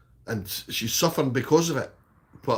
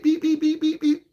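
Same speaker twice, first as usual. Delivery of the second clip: high squeaky beep beep beep; mock electronic beeping; imitated detector beeps; 14 semitones higher than usual. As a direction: mimic the metal detector going off, higher.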